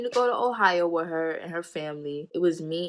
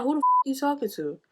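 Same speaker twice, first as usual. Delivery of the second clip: mock confrontational snap; 8 semitones higher, faster than usual. Confronting her.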